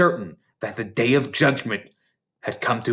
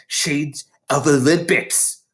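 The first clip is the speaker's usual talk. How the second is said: usual speaking voice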